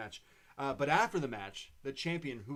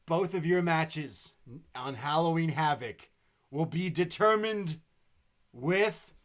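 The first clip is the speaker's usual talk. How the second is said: mock dramatic host; 2 semitones higher, 7 dB louder than usual. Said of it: "way over the top in dramatic mode".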